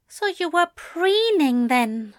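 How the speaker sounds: high-pitched, pointed murmur; prim angel's arch murmur. A narrator's reading. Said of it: "Aziraphale murmured".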